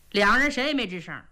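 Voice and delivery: plain reading voice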